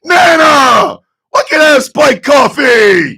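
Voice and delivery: high, belted whine to grandma; mock child's yell. Calling to Nana about coffee, high mock yell. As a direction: holler it up like a thwarted kid.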